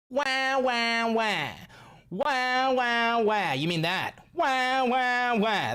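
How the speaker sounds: high, loud wah wah crybaby noise; mock crying baby. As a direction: whine it like a bawling toddler. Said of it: "do you mean like this".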